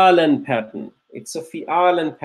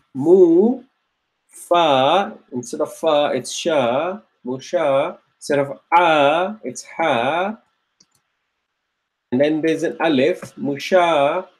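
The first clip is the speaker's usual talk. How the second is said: walk students through each letter slowly; slowly, piece by piece; slower than usual. Shown as a demonstration. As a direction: build musha one sound at a time. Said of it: "So I would go slowly".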